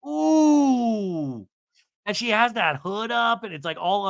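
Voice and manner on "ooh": long, high, loud "ooh"; scandalized ooh. Real, not acted. Host reacting to a cutting retort, long scandalized ooh.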